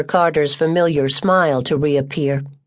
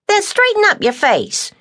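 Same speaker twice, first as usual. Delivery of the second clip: much higher, scolding woman's voice; stern Tucker. Acted. Tucker scolding sulky March, high and firm. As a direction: order him to fix his face.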